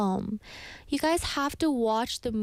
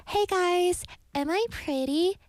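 high, coaxing copycat voice calling out; mimic monster's lure; 9 semitones higher than usual. Mimic tiger calling to hikers on the mountain, high sweet lure. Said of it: "you would hear".

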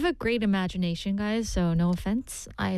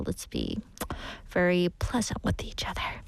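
whisper; 3 semitones lower than usual. Real, not acted. Soft closing advice.

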